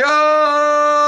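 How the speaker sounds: long, high, loud sustained yell; drawn-out charging-army shout; soldiers' war cry. Acted. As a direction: hold a battle yell on one high note. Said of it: "all the Israelite soldiers started shouting".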